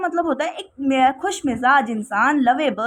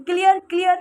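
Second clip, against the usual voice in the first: teacher checking in; 4 semitones higher than usual. Retold or imitated. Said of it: "जैसे आपको बार बार teacher पूछ रहे होंगे".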